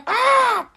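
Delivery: high-pitched mock squawk; seagull scream. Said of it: "the seagull was going".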